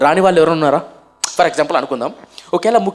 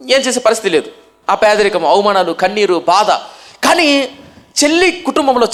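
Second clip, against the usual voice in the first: raised, higher-pitched preaching delivery; ఉద్వేగ ప్రసంగం; 7 semitones higher than usual. Real, not acted. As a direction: preach it out with rising force to the congregation. (ఉద్వేగంగా, స్వరం పెంచి ప్రసంగం)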